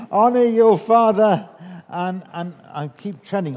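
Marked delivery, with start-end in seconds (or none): 0.1-1.5 loud and emphatic; loud proclamation. Shown as a demonstration. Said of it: "not supposed to say that with that expression".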